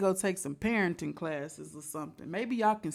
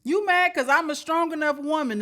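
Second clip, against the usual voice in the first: sassy scolding; 8 semitones higher, 3 dB louder than usual. Real, not acted. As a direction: tell her off with attitude. Reaction creator scolds the mom.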